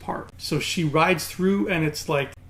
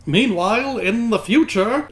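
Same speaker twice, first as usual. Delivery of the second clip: big trailer-narrator "meanwhile"; dramatic announcer voice; 6 semitones higher, 4 dB louder than usual. Pretend. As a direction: boom it like a cartoon narrator. Dramatic scene-shift announcement.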